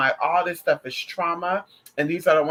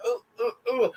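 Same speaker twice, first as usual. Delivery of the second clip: cringing ooh; 5 semitones higher than usual. Real, not acted. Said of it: "I'm like".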